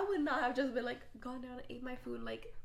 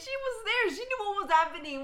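outraged; 9 semitones higher, 8 dB louder than usual. Real, not acted. Outraged at the ex story.

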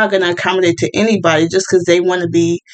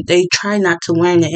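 ordinary talk, nothing marked

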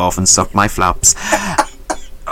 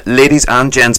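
ordinary talk, nothing marked

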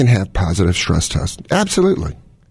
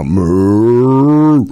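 long rising moo, getting louder; cow moo; 3 semitones higher, 5 dB louder than usual. Pretend. Doctor to his guest, joking about milk, a cow moo. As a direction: draw out a moo that swells and climbs.

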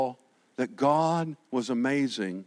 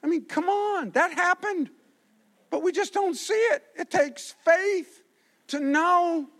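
fiery pulpit proclamation; full-force preaching; 14 semitones higher than usual. Acted. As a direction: preach it out to the back row. Preaching peak, praise of God.

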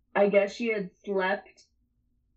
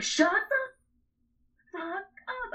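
much higher, slow drawn-out annoyed whine; groggy snap; 12 semitones higher, much slower than usual. Retold or imitated. Telling Lindsay to shut up again, slow high whine. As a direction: drag it out, high and fed up. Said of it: "I was like".

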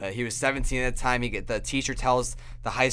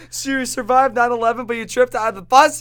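incredulous son; 12 semitones higher, 10 dB louder than usual. Retold or imitated. Son's incredulous question.

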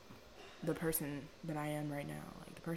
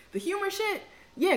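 excited; 13 semitones higher, 8 dB louder than usual. Real, not acted. Sudden high excited burst.

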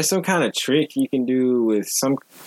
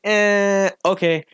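hesitant okay; 10 semitones higher, much slower than usual. Retold or imitated. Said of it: "And she was like".

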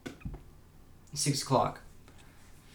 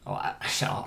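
normal talking voice

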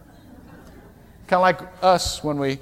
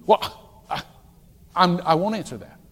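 ordinary delivery

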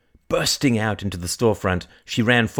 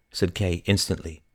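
ordinary delivery

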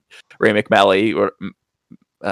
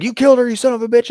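high, fast acted accusation; mock frustrated outburst; 12 semitones higher, faster than usual. Detective accusing the suspect at the dig, acting frustrated. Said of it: "he gets frustrated".

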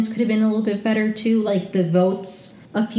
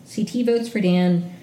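usual speaking voice

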